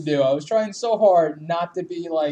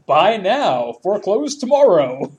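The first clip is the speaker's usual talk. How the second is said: mock sales slogan; 4 semitones higher, 6 dB louder, slower than usual. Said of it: "mocking realtor slogan".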